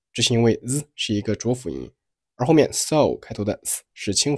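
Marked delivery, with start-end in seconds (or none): none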